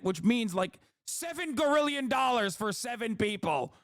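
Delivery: hammed-up rich owner ranting at the poor; greedy corporate owner. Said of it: "these motherfuckers are still like".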